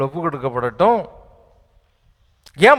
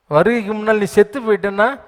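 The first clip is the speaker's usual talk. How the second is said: usual speaking voice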